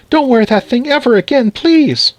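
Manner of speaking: high-pitched, anxious plea; pleading girl. Acted. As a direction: beg in a high little girl's voice. Lucy pleads, hates mice.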